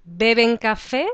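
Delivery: "¿Beben café?" as a question, rising at the end on "café"; projected yes-no question with a rise on "café"; question intonation. Shown as a demonstration. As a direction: lift "café" upward to make it a question, loud and clear. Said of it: "change the intonation of your voice".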